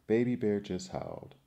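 usual speaking voice

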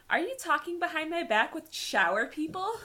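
put-on half-play the outraged girlfriend yelling; a hammed-up jealous shout; mock yell. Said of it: "this is where Mercedes yells".

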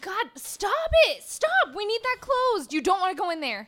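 high, urgent pleading to stop; alarmed pleading. Acted. Livy urgently pleads with the elf to leave the doors shut. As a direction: beg him not to open the doors.

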